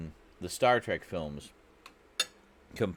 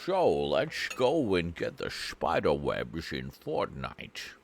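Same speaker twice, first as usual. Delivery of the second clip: slow, rolling Scottish brogue in the style of Sean Connery; slow mock Scottish Sean Connery voice; Sean Connery impression; 3 semitones lower, slower than usual. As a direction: drawl it out slowly like a Scottish film star. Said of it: "He put you as Sir Sean Connery".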